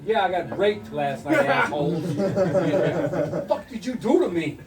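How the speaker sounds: mock outraged. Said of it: "They're like".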